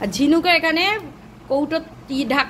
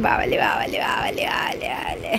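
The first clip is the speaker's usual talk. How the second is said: breathy cooing; 4 dB quieter, slower than usual. Real, not acted. Parent petting child at bedtime, breathy cooing. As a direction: coo it on the breath, stretching the end.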